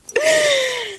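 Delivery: high, squealing held laugh; high-pitched laugh. Real, not acted. Joking about hairy legs on camera, high laugh.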